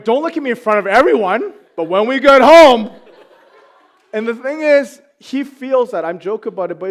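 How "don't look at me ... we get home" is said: loud, laughing dad warning; joke-threaten the son, louder; mock threat. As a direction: coach dad teasing his son.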